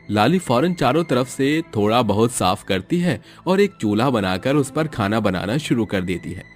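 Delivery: usual speaking voice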